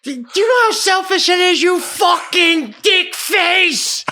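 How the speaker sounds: high, loud rant slowing into a drawn-out insult; furious screaming. Retold or imitated. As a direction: scream in outrage, stretching the last words. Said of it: "And I'm screaming at him".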